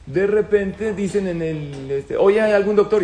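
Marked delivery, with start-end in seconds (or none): 2.2-3.0 retold call for a doctor, louder and higher. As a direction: call out over the cabin for a doctor. Flight crew calling for a doctor.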